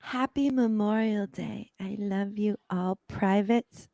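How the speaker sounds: slow, soft, breathy wishes; whisper-soft holiday greeting to the troops. Retold or imitated. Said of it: "He's like".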